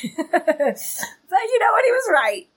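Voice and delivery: slow laughing concession. Real, not acted. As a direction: laugh through the admission. Laughs at her own anger.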